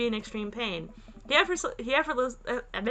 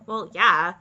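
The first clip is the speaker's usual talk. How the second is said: usual speaking voice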